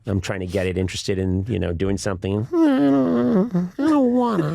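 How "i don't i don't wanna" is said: high, loud whining refusal; hammed-up sulky wail; petulant whine. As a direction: whine like a balky little creature. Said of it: "kinda petulant and whiny".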